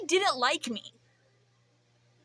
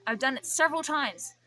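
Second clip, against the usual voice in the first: ordinary talk, nothing marked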